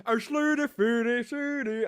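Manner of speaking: high, loud Muppet-chef gibberish; sing-song it like the Swedish Chef; a goofy sing-song chef voice; Swedish Chef impression. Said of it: "sounds like the Swedish chef from the Muppets".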